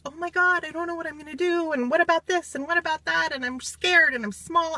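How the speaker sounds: high, fast, fretting worried voice; mock panicky little self. Arriving at the weekend in her small self, high panicky fretting. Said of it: "in my little self".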